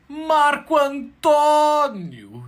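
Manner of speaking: stretched-out, very high joyous call of his name; ecstatic greeting. Acted. Neighbour hugging Marco Antônio, calling his name in high, stretched-out delight. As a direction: greet him like a long-lost friend.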